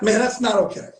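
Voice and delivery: ordinary talk, nothing marked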